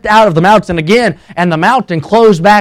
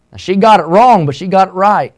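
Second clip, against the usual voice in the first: ordinary talk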